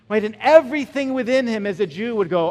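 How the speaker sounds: ordinary talk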